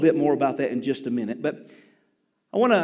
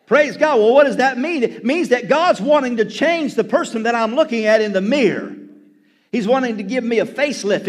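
louder, higher, driving sermon delivery; fired-up preaching; 4 semitones higher, 6 dB louder than usual. Real, not acted. Preacher urging the church to let God change them, fired up. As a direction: drive the point home to the congregation.

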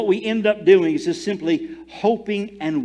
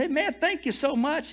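grateful thanks; 11 semitones higher than usual. Retold or imitated. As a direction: thank him lightly and brightly. Retold thanks.

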